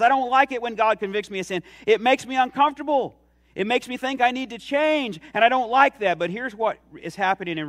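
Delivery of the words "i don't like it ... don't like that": higher, louder whiny complaint about change; grumbling flesh. Pretend. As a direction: complain like someone who won't change. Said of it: "our flesh says".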